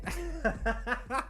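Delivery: real soft, high chuckle; laughing. Real, not acted. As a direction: let out a quiet, high giggle. Breaks up while explaining his theory.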